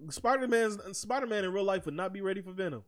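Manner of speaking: ordinary talk, nothing marked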